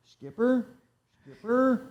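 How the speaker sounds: high, searching call of a name; mock calling out. The driver searching for his missing Skipper, calling high. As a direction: call out as if looking for someone in the dark.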